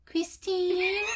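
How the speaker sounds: high drawn-out call of a name; mock sing-song call. Roommate calling sister from her room, high sing-song. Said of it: "she'd be like".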